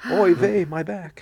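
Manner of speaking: put-on high Yiddish-accented kvetch; kvetching Yiddish-accent complaint. Joking echo of back pain.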